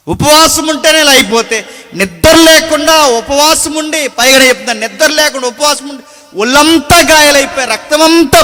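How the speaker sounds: loud, driving sermon cadence with punched phrases; fiery preaching. Acted. Preacher booming to the congregation about the cross. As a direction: preach it hard to the crowd.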